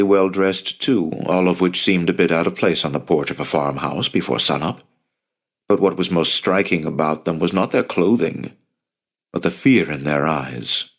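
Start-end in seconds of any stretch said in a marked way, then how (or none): none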